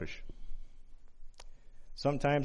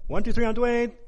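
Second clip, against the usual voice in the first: loud, high shout across a yard; yell; 12 semitones higher, 11 dB louder than usual. Retold or imitated. Five-year-old calling out his friend in hide and seek, yelled. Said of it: "So I yelled".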